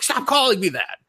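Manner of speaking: high, whiny protest in a put-on voice; annoyed protest. Guy teased as chihuahua, high annoyed protest. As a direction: squeak out the complaint, fed up.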